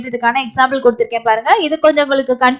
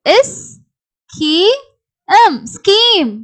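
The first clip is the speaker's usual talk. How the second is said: slow, higher-pitched separate sounds, then blended; sounding out; 5 semitones higher, much slower than usual. Shown as a demonstration. Teacher showing a similar word, sounded out. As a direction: pause between each sound, then join them. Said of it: "அதே மாதிரி இது scheme".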